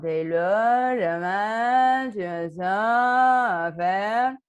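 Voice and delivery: voix comique, plus forte et très lente; étire chaque mot comme un dessin animé. Shown as a demonstration. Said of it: "Donne une voix comique, une voix trop haute". (voix comique ralentie et appuyée)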